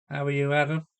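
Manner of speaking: ordinary delivery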